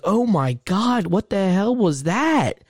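slow, high-pitched retold alarm; re-enacted horrified amazement, drawn out; stunned disbelief. Reacting to monstrous sound in retelling.

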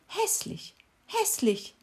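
high breathy mocking chant; mocking taunt. A narrator's reading. Cricket remembering the beetle's taunt, high and mocking.

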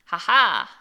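loud sneering witch cackle; sneering panto villain. A narrator's reading. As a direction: cackle in cruel triumph. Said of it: "ricana-t-elle".

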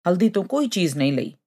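ordinary delivery, nothing marked

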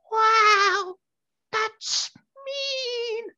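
very high, drawn-out falsetto; wounded lady in a squeaky mock voice; imitated street vendor. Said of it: "We imitate that voice all the time".

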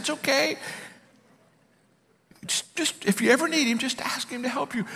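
high, hushed comforting voice; gentle reassurance. Retold or imitated. Coach urging doubtful colleague to just ask, gently soothing. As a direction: softly coax him, pitched high.

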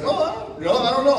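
high, dithering clueless voice; clueless officer. Retold or imitated. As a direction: fumble like you have no idea. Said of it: "He's like".

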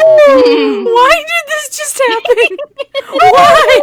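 high, loud, slow laughing cry of disbelief; laughing wail. Real, not acted. Friend reacts to the gross story, laughing loud and high. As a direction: wail it out through real laughter.

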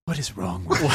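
lightly voiced baffled hobgoblin. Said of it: "the hobgoblin looks at you and just goes".

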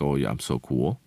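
normal talking voice

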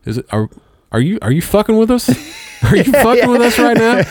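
put-on high, laughing disbelief of a young girl; a kid squeaking in mock outrage; incredulous kid. Daughter to dad about invisible birds, high incredulous squeak. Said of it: "they were both just standing there looking up, like".

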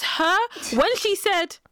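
ordinary talk, nothing marked

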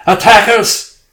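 high, loud panicked scream; screamed warning. A narrator's reading. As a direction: yell the alarm at full volume. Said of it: "Then he screamed".

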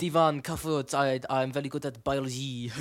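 put-on French accent with a throaty lead-in. Demonstrating a French accent to friends, mock French accent. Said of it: "starting a French accent".

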